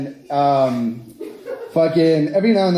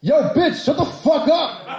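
higher mock-tough snap, slowed; camera guy's retort; 10 semitones higher than usual. Comedian voicing the cameraman telling her off, mock snap. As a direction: bark it like the annoyed cameraman.

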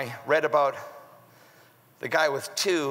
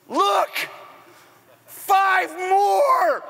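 much higher, loud shouted "Look, five more"; fired up shout; 13 semitones higher, 4 dB louder, much slower than usual. Acted. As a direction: burst out with the reveal. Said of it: "Is he, is he fired up?".

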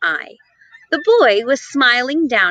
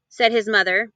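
plain reading voice

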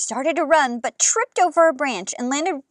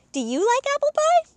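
eager question. Real, not acted. Asks kids directly.

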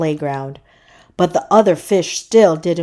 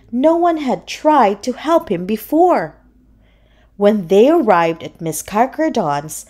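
ordinary delivery, nothing marked

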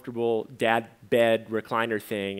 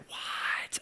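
breathy whispered what of disbelief; shocked whisper; 12 dB quieter than usual. Retold or imitated. Old college friend hearing he married, shocked whisper. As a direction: gasp it out, stunned. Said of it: "he was shocked that I got married".

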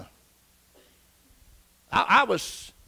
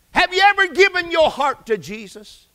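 raised, louder voice of an insistent aunt; pressing question; 10 semitones higher, 7 dB louder than usual. Retold or imitated. Godly aunt challenging her nephew about his faith, pressing. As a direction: put the question high and forcefully.

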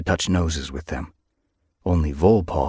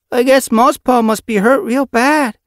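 high, loud little kit voice; exclaiming kit; 9 dB louder than usual. A narrator's reading. Young kit leaving the accident, high exclamation. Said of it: "Volkit exclaimed as they left".

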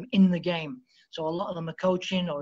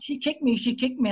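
higher, whiny imitation of a crying man; mock crying; 6 semitones higher than usual. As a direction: mimic a grown man whimpering, half-mocking. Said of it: "he would come up to me crying".

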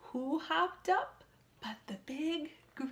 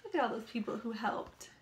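usual speaking voice

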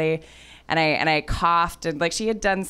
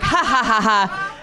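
awkward laugh; 4 semitones higher, 4 dB louder than usual. Retold or imitated. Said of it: "I was like".

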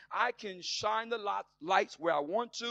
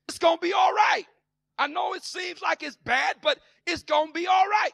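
high, loud prophetic promise; Elijah reassuring; 10 semitones higher, 9 dB louder than usual. Retold or imitated. Prophet Elijah promising a famished people relief, booming. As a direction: lift them with a booming promise. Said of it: "Elijah steps up and says".

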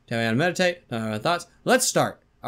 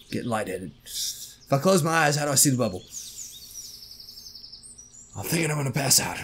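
usual speaking voice

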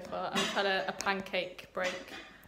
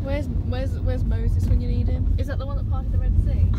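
loud; 3 semitones higher, 12 dB louder than usual. Real, not acted. Joking over a flood.

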